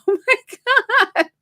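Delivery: squeaky high oh my God, laughing in shock; stunned disbelief. Retold or imitated. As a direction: caught off guard and amused. Mother says it's about time.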